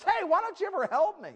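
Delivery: high, loud mock outburst; exasperated mom. Flustered mom to dad reading the newspaper, exasperated yelling. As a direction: yell for help, fed up.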